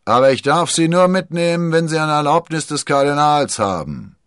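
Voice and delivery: high, loud official shout; captain bellowing his orders; ship's captain. A narrator's reading. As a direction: call the condition down from deck.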